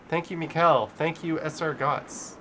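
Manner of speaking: usual speaking voice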